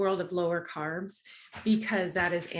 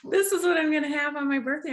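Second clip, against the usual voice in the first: excited; 8 semitones higher, 5 dB louder, faster than usual. Real, not acted. Excited about birthday cake.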